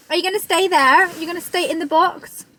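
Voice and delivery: high, bright pet-talk question; talking to dog. Real, not acted. Asking the dog in the boot to stay, high pet talk.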